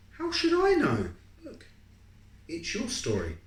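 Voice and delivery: a teasing sister shrugging it off; exasperated sister. Acted. Sister voice in story.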